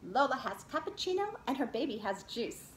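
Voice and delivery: plain reading voice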